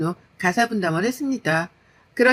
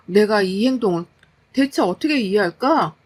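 ordinary delivery

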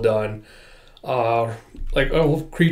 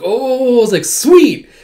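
delighted exclaim; 10 semitones higher, 7 dB louder, slower than usual. Retold or imitated. Character delighted by gift.

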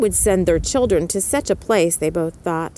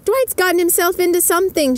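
much higher character voice, called out; Mellie voice; 11 semitones higher, 3 dB louder than usual. A narrator's reading. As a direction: pitch it way up, calling across the room. Mellie calls out to Callie.

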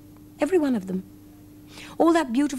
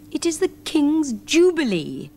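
higher, slow proud bear voice; proud teddy bear; 8 semitones higher, 4 dB louder, slower than usual. A narrator's reading. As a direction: lift the voice like a proud little bear. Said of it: "said the king's teddy bear".

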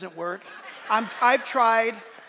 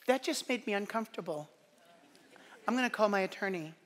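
soft, put-on wounded voice; mock offended complainer; 7 dB quieter, faster than usual. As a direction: imitate a touchy complainer, kept small. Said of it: "people don't talk like that".

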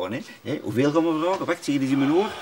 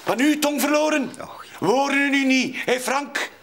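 taunting; 12 semitones higher than usual. Acted. Taunting a silent man.

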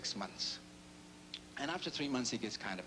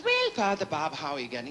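louder, higher Irish brogue; Irish bishop; 4 semitones higher, 8 dB louder than usual. Acted. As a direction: bishop greets him in brogue. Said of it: "He says".

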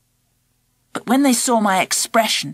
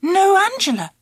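plain reading voice

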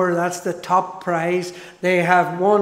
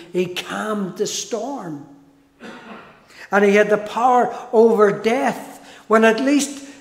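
normal talking voice

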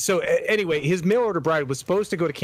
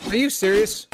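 high, louder startled question; alarmed disbelief; 8 semitones higher, 4 dB louder than usual. Real, not acted. Player thinking they are getting attacked, startled. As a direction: demand it in sudden disbelief. Mistook repair noise for an attack.

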